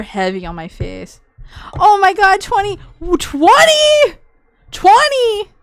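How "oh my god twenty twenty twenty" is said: real shock, high loud yelling; excited shout. Real, not acted. Streamer yelling over twenty gifted subs, excited shout. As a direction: shout the number in disbelief.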